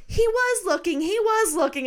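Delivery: lightly voiced boy insisting he was looking; kid protesting. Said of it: "Memphis is like".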